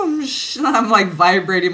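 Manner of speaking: ordinary talk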